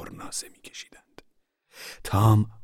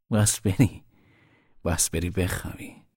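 usual speaking voice

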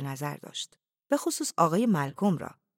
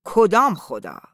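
loud, explosive growled challenge; angry roar; 3 semitones higher, 11 dB louder, slower than usual. A narrator's reading. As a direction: roar the question at your opponent. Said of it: "مختاروف قرید". (غرش بلند و خشمگین)

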